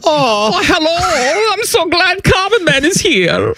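high-pitched, drawn-out mock German accent; high comic German greeting; Common Man's German accent. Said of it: "we need the common man".

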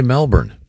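Melbourne with a full R, "MEL-burn"; American pronunciation. Shown as a demonstration. Host rejecting the locals' way, Melbourne said "MEL-burn". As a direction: sound the R in Melbourne. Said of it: "that's only for the locals".

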